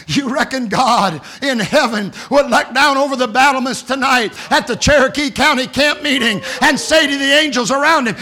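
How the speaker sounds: normal talking voice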